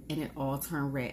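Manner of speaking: ordinary talk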